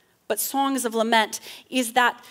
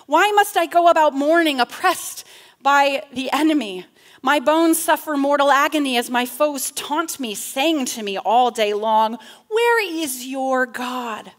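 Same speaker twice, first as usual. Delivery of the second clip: loud, raised plea to God; wrestling lament; 3 semitones higher, 5 dB louder than usual. Acted. Psalmist wrestling with God, forceful lament. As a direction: cry out the psalm's complaint forcefully. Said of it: "But still the wrestling".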